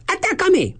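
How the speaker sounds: high defiant shout. Acted. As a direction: dare him at full voice. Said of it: "gritó Adrián". (grito desafiante)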